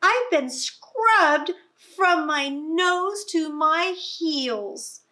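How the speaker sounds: high, slow, loud piggy voice; squealing pig. Acted. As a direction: whine like a pig fresh from the tub. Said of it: "the pig squealed".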